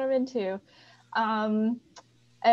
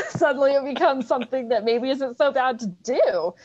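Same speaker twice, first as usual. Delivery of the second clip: laughing; 5 semitones higher, 5 dB louder than usual. Real, not acted. Laughing at the absurd idea.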